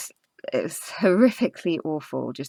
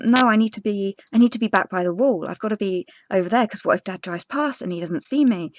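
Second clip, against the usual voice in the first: panicked insisting; 6 semitones higher, 4 dB louder than usual. Retold or imitated. Panicking, insisting on returning to wall.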